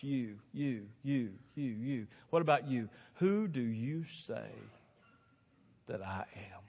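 slow question fading to a whisper; slow right down and let the last words sink away; hushed question. Retold or imitated. Quotes Jesus's searching question.

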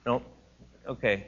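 normal talking voice

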